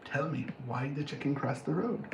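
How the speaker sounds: low, soft prompting voice; hushed joke setup. Acted. Clown prompting for the riddle again, low and hushed. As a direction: press the riddle quietly.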